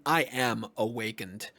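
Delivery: ordinary delivery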